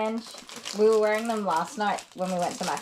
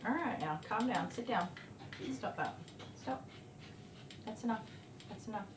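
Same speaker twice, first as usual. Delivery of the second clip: soothing dog; 13 dB quieter than usual. Real, not acted. Calming a squirming dog.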